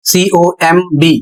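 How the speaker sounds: ordinary talk, nothing marked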